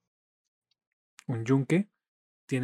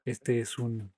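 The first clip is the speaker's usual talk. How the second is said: ordinary talk, nothing marked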